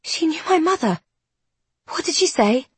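high, stunned, breathless; gasping shock. A narrator's reading. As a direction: blurt it out in shock. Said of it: "Isby gasps, a strangled sound".

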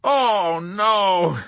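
high, loud moan; wailing groan of dismay. A narrator's reading. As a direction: moan it in despair. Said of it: "moaned General Peckham".

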